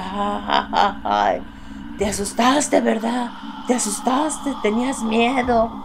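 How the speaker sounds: mock the scared listeners; retold demonic taunt; Machiavellian voice. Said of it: "una voz así maquiavélica".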